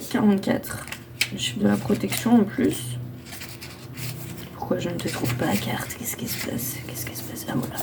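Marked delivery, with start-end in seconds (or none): 4.6-7.7 whispering. Real, not acted. Searching for the lost card.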